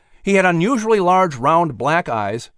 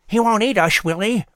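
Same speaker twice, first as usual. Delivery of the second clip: nervous squirrel voice; 6 semitones higher than usual. Acted.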